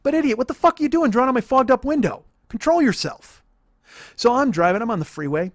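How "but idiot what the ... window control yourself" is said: loud, high-pitched comic tirade; exasperated scolding. Acted. Driver scolds passenger for drawing on the window, exasperated. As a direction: tell him off at full exasperation.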